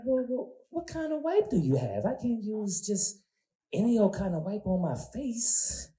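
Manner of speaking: put-on high, soft, slow prissy girl voice; sweet girl voice. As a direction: fuss about it high and slow. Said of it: "sweet girl's voice".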